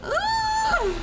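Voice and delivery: high effortful grunt; strained grunt. Real, not acted. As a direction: push through the jump. Straining at game.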